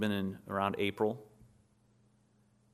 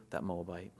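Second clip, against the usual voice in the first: scornful Moabite; 4 semitones lower, 6 dB quieter than usual. Retold or imitated. Preacher voicing the town's scorn.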